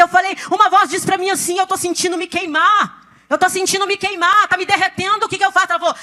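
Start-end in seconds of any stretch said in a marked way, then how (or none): 1.6-5.7 higher-pitched, pleading cry for help; imitated distress, voice raised in pitch; retold panic. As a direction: act out alarm at burning up. Retold panic, feeling herself burn.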